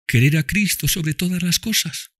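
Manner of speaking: put-on high child voice, tender; dying girl speaks lovingly to Tom and Mami, high child voice; loving little girl. A narrator's reading. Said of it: "dijo Eva".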